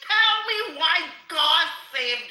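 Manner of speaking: loud high cry, half laugh half sob; laughing shout. Real, not acted. Demanding of the host why God saved the queen, shouting. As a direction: shout it through laughter and tears.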